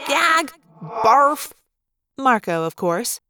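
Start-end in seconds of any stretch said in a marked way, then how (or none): 0.1-1.5 higher, punchy exclamations of revulsion; mock disgust. Marco reacts to the girls' crush, hammed-up disgust. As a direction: play up the disgust, one word at a time.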